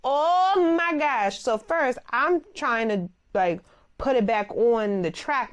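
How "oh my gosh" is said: high, drawn-out oh my gosh; mock alarm at the hanging door; shocked gasp. Groomer reliving the broken garage door, slow shocked. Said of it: "I said".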